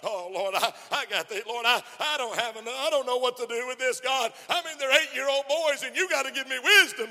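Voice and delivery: high, loud pleading to God; a new teacher's loud, pleading prayer; begging prayer. Retold or imitated. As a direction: beg the Lord for help, voice climbing. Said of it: "you begged God".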